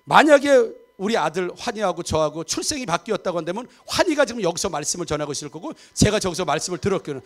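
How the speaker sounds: ordinary talk, nothing marked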